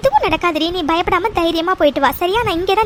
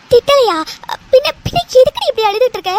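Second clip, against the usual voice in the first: higher, louder worried questioning; alarmed bewilderment; 5 semitones higher, 5 dB louder than usual. Acted. Friend baffled by Vaishu's tears, urgently concerned. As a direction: demand anxiously why she is crying.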